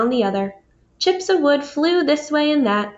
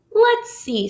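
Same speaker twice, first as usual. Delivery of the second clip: doubtful Beaver; 10 semitones higher than usual. Acted. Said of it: "said Beaver".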